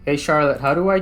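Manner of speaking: calling out. Real, not acted. Player hails the assistant to ask a question, calling out. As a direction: call out to get the helper's attention.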